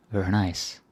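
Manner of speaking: ordinary talk, nothing marked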